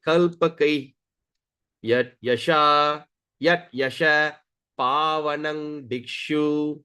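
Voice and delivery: chanted phrase by phrase; slow pronunciation demo. Shown as a demonstration. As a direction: hold the long vowels, pause between words.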